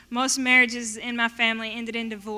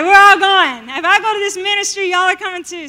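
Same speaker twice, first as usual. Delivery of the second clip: louder, higher, fast commanding shout; insistent loud rally; 7 semitones higher, 11 dB louder than usual. Retold or imitated. Rounding up friends for the ministry, loud insistent shout. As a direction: drag your buddies along with you.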